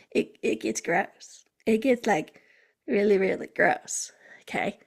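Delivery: a light hint of a Southern accent. Podcaster mocking a stagnant pond in a light Southern accent. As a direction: slip into a touch of Southern, then drop it. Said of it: "I went Southern".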